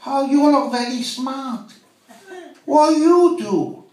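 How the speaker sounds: slow, high mock Chinese accent; hammed-up Chinese English, drawn out and high; a chip shop owner quizzing a customer in a slow, high mock Chinese accent.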